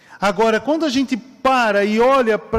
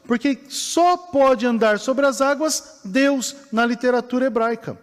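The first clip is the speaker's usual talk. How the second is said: normal talking voice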